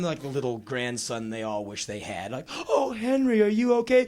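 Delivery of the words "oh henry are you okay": higher cooing concern; doting elders. Retold or imitated. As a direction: coo over the little grandson. Tour group fussing over boy.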